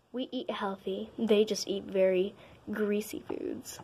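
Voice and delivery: snide gossiping whisper. Acted. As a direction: whisper it behind their backs. Mean girls whisper gossip.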